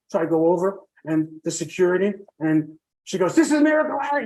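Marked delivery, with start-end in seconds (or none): 3.3-4.2 high-pitched imitation of a proud announcement; excited introduction. Nurse presenting her miracle patient to security, excited introduction. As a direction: mimic her showing him off, pitched well up.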